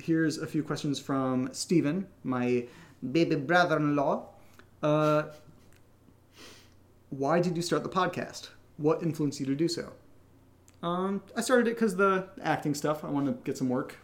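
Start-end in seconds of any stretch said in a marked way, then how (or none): none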